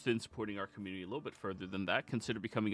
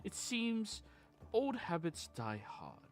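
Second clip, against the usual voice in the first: storyteller recounting a tale, measured and grand; grand rhythmic bard; 3 semitones higher, 5 dB louder, slower than usual. Acted. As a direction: tell it like a bard by the fire. Bard recap of the session.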